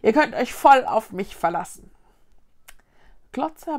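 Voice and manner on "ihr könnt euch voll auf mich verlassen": loud, cocky reassurance; boastful promise. Acted. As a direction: sell it like you can't fail. Vicky boasting to friends.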